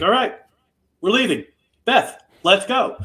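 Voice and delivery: a retold hero snapping out a command to leave; tough hero giving orders. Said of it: "and he's like".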